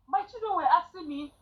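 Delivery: usual speaking voice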